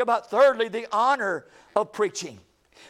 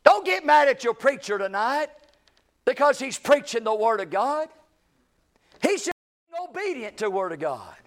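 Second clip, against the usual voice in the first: higher and louder, a fervent sermon climax; preaching at full force; 7 semitones higher, 8 dB louder than usual. Acted. Preacher urging his congregation to honor their pastor, fervent full-force preaching. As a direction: shout it out to the church, rising with every line.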